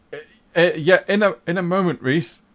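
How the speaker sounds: high, loud, stammering excuse; nervous stalling. Acted. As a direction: stammer and play for time. Henry, drained of colour, stalls Reese.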